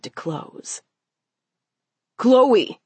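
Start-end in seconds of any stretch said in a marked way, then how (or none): 2.2-2.8 raised, louder shout of a name; booming call. Acted. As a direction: call out to her urgently. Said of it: "Amo's voice boomed".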